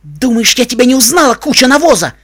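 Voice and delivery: high, loud, enraged yelling; furious bark. A narrator's reading. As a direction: roar it at an enemy in fury. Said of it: "рявкнула она".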